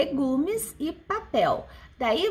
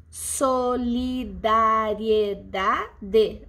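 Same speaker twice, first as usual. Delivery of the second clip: "solidariedade" very slow, every syllable held apart; 4 semitones lower, much slower than usual. Shown as a demonstration. Teacher counting a long word's syllables for class, syllable by syllable. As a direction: count out "solidariedade" syllable by syllable on a low, level pitch.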